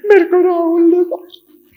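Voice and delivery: high, loud mock child sob; crying child. Little boy crying to his mother, high mock sob. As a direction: wail like a hurt little boy.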